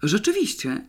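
mildly puzzled, tentative agreement; uncertain interest. A narrator's reading. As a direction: sound unsure but curious. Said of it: "zainteresował się niepewnie sekretarz redakcji".

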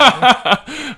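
high, loud burst of laughter. Real, not acted. Laughing after being called pervert.

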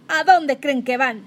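shout. Acted. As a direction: yell to stop them. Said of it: "grité".

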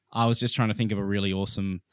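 usual speaking voice

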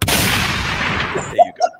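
breathless high laughing words. Real, not acted. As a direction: squeak it out through laughter. Laughing reaction.

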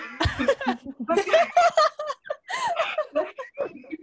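long high-pitched laughing fit; helpless laughter. Real, not acted. Losing it mid-answer to a friend, helpless laughter. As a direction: crack up and keep laughing.

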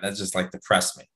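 usual speaking voice

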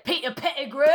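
hammed-up Cockney impression; Cockney accent. Shown as a demonstration. Friend showing off the best Cockney phrase, mock Cockney accent. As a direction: put on a broad, playful Cockney accent. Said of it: "a Cockney accent".

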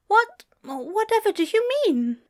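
high, flustered stammer. A narrator's reading. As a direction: stumble on the first word, pitched high. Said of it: "he stammered in reply".

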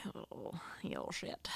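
breathy whisper to a pet. Real, not acted. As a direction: hiss it softly, fond and teasing. Cooing at the cat.

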